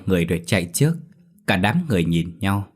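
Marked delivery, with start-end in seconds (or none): none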